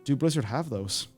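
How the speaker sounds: ordinary talk, nothing marked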